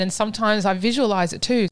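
ordinary talk, nothing marked

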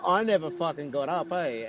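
mock-indignant retold insist; insistent denial.

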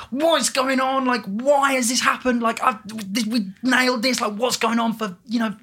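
high, loud, exasperated ranting; retold anger. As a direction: vent the frustration after the show. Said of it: "And I was just so angry".

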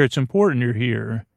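ordinary delivery

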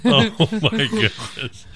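high, drawn-out exasperation; exasperated. Real, not acted. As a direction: sigh it out in disbelief. Exasperated at her.

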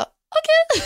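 a high, drawn-out startled okej; squeaky shocked "okej" falling away; stunned okay. Retold or imitated. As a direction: react like the news just dropped on you. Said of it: "Och jag bara".

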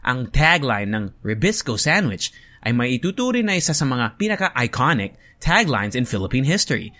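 American-accented English words inside Filipino-accented Tagalog; switching accents. Shown as a demonstration. Host showing what it would sound like, American-Filipino accent switching. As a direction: flip to American English for each English word. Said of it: "in my English accent for every English word".

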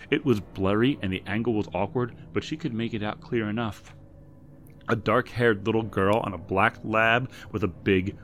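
ordinary delivery, nothing marked

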